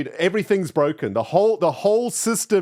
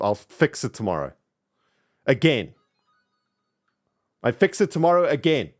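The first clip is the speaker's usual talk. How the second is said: ordinary talk, nothing marked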